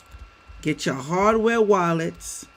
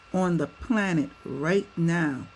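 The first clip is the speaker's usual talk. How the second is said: ordinary talk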